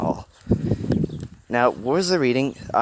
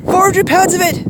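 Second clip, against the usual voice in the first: high, loud burst of excitement; excited; 6 dB louder than usual. A narrator's reading. Woman showing Hiro the metal ball, excited. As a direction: exclaim it much higher and louder. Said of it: "the woman declared excitedly".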